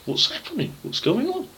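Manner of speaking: voiced high and questioning; puzzled elder brother. Elder brother calls out to a servant, puzzled and high. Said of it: "he said to one of the servants".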